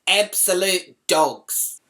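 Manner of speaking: drawn-out mock yell of abuse; slow, scornful holler, partly held back; retold yell. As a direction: yell the insult slowly, stretching each word. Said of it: "I just yelled out".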